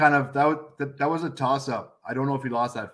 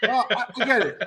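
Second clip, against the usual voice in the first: laughing; 8 semitones higher than usual. Real, not acted. Laughing while conceding.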